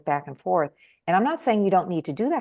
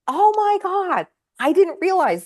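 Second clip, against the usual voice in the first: stunned realization; 14 semitones higher, 5 dB louder than usual. Retold or imitated. Husband realizing what his wife saw: very high amazed cry. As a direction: caught off guard by the obvious.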